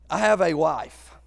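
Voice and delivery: ordinary delivery, nothing marked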